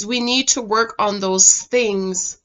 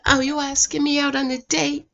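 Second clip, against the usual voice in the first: coy imitation; 4 semitones higher, 7 dB quieter than usual. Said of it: "she said".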